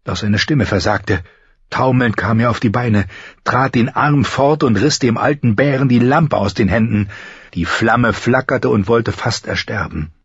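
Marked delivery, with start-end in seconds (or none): none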